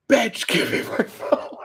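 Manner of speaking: high strained mock scream; squeezed, exasperated holler; angry yelling. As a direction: imitate a fed-up man yelling up. Man yelling up at her window.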